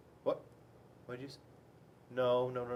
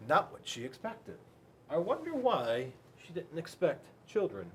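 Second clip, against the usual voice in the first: plain reading voice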